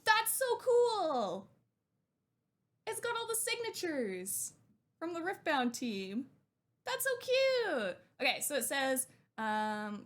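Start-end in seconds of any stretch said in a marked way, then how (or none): 0.0-1.5 high and slow, gasping with real delight; excited gasp. Real, not acted. Gasps at signed card. 6.9-8.0 very high, slow, gushing; delighted squeal. Real, not acted. Delighted by signatures.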